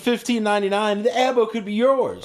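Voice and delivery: high, hammed-up sales pitch; infomercial voice. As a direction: sell it like a TV ad. Mock infomercial.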